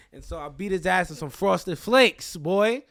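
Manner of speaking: loud, high, triumphant brag; boastful punchline. Real, not acted. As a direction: belt out the punchline with swagger. Boasting punchline of fight story.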